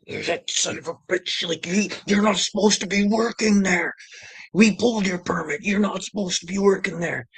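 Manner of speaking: high-pitched mocking imitation of an official; mock scolding. Inspector catches him working without a permit, mock high-pitched scolding.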